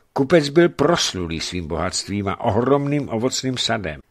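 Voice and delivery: ordinary delivery, nothing marked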